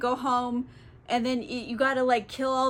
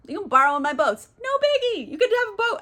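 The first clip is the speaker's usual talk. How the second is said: much higher, excited generosity; overeager host; 9 semitones higher, 5 dB louder, faster than usual. Retold or imitated. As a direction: gush like a proud host. Generous king voice.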